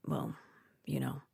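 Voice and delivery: hushed, trailing whisper. Acted. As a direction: drop to a guilty whisper. Dad avoiding saying murdered.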